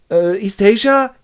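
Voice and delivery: unsure and scared; timid answer. Acted. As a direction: Winston answering his torturer timidly. Winston answering O'Brien.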